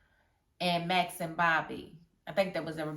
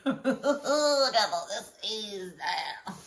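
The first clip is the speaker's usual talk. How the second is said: laughing exclamation; 2 semitones higher, much slower than usual. Real, not acted. Laughing reaction to the scene.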